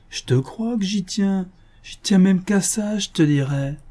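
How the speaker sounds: high, drawn-out eager assurance; keen, high-voiced confirmation; emphatic insistence. A narrator's reading. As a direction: insist on it, pitched well up and stretched. Emphatic answer to his question.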